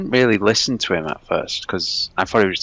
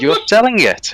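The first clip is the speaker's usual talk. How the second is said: high, loud mock Polish voice; imitated Polish accent; 11 semitones higher, 5 dB louder than usual. Polish buyer insisting to a baffled homeowner, high mock Polish accent. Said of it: "Very Polish".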